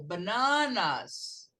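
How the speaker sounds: "bananas" slow, with long stressed middle NA; English plural. Shown as a demonstration. Teacher models the plural for students, "bananas" slow and stressed. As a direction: stretch "bananas" on the middle syllable, b'NAA-nas. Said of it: "no plural".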